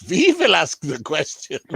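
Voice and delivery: put-on West Country accent. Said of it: "That's a terrible West Country accent".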